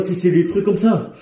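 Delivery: ordinary delivery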